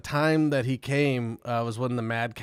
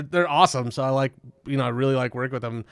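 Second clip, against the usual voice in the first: ordinary talk, nothing marked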